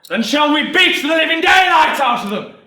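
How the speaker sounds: high, loud rallying cry; rousing shout. Acted. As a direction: bellow the challenge to the whole square. Sergeant whips up the crowd.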